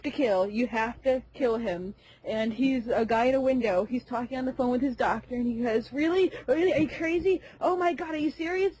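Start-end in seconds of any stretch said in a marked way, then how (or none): 5.9-8.7 higher-pitched, overjoyed disbelief; giddy, high-voiced astonishment; happily freaking out. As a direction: imitate someone freaking out at good news. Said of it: "he's freaking out. He's so happy".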